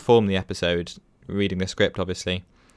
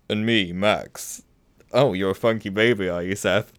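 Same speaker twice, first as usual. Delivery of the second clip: Max's smooth radio voice. Pretend. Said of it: "low, smooth radio voice".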